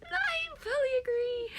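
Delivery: high, still laughing. Real, not acted. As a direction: agree through giggles. Laughing agreement.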